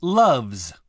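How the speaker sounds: "Loves" ending in a voiced z; final S voiced. Shown as a demonstration. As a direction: say Loves with a buzzing z at the end. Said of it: "loves はず".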